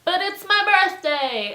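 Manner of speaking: high, drawn-out loud objection; loud birthday protest. Retold or imitated. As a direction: protest loudly, stretching it out. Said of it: "he just kept saying this and getting louder".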